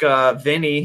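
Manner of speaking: ordinary delivery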